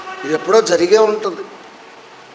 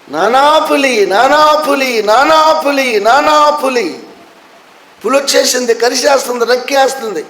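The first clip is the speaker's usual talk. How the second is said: higher, louder panicked cries for dad; a boy screaming for help as the tiger bites, higher and louder; desperate cry; 6 semitones higher, 4 dB louder than usual. Retold or imitated. Tiger truly attacks the boy.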